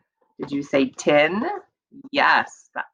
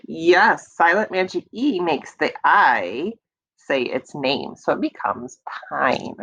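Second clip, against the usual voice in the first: ordinary talk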